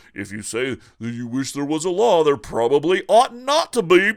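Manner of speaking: mocking libertarian. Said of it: "school of thought that says".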